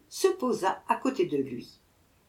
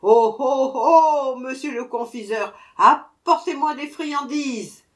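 louder, higher shout; dwarf shouting louder still; even louder cry; 5 semitones higher, 7 dB louder than usual. A narrator's reading. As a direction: bellow it, annoyed now. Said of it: "cria encore plus fort".

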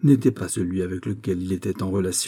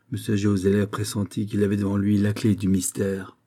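ordinary delivery

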